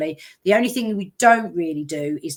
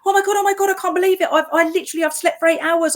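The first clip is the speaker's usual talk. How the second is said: much higher, louder gushing; excited friend; 10 semitones higher, 4 dB louder, faster than usual. Retold or imitated. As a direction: imitate her breathless amazement. Retold friend's excited call.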